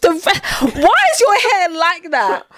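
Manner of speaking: high, incredulous mock question; retelling her reaction to a TikTok guy with high mock disbelief. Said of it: "I was like".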